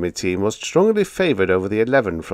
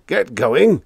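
roared; 7 semitones higher, 5 dB louder than usual. A narrator's reading. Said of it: "roared the old man".

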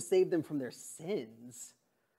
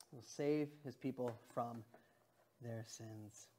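much softer, lower repetition; quiet; 6 semitones lower, 15 dB quieter than usual. Acted. Pastor repeating the verse softly to the congregation. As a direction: let the words sink in hushed.

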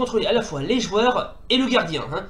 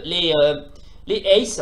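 normal talking voice

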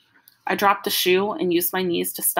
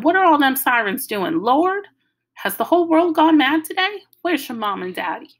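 alarmed grandmother; 7 semitones higher, 5 dB louder than usual. Acted.

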